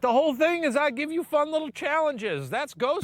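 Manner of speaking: high-pitched pleading objection; indignant protest. Real, not acted. Host defends his fun challenges, high indignant protest.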